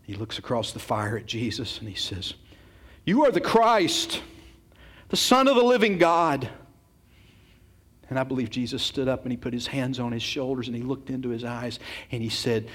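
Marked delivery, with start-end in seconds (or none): 3.0-6.5 bold confession. Acted. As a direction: declare it to the whole fire. Peter stands and confesses.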